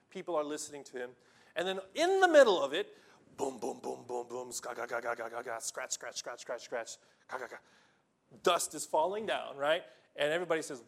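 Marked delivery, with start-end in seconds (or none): 3.4-7.6 low, soft boom and scratch sound effects; quiet imitated crashing and scraping; roof crashing noises. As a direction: mimic digging through a roof. Imitating the roof being broken open.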